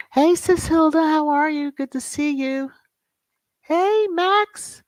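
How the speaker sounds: high, gleeful singing hail; sing-song greeting. Real, not acted. Calling out greetings to viewers.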